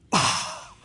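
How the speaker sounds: breathy, unvoiced gasp of shock; mock-offended gasp. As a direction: gasp as if affronted by the request. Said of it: "And she went".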